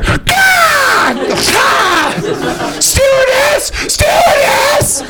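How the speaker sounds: high shrieking panic calls for the stewardess; panicked yelling. Retold or imitated. Nervous flyer shrieking at the falcon on takeoff, high panic. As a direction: scream like a terrified passenger.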